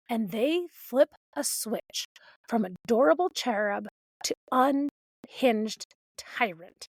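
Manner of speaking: ordinary talk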